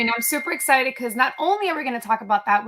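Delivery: ordinary delivery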